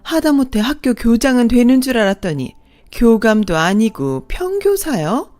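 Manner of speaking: second mother-in-law belittling the bride's parents, sneering. A narrator's reading. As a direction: look down your nose and jeer. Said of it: "더 가관인 건 둘째 어머니였어요".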